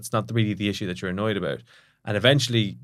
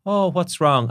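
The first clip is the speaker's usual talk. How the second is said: higher, mock-worried parent; concerned parent; 8 semitones higher, 4 dB louder, slower than usual. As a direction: coax the sulking child gently. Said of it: "parent asking sulky child".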